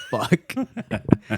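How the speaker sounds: high, loud laughing what the fuck; cracking up in disbelief. Retold or imitated. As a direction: burst out in shocked laughter. Shocked by the lying interviewer.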